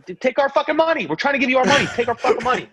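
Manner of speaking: high, loud, fed-up pleading yell; outraged fan shouting at the front office; exasperated yell. Real, not acted. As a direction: yell it like a fan begging them to take his cash. Fan ranting at team owner.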